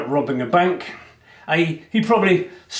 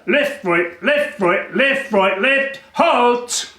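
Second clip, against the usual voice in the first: a light sing-song left, right, ending in a higher halt; marching call; 7 semitones higher, much slower than usual. Retold or imitated. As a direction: call the march like a drill sergeant. Marching guards imitated.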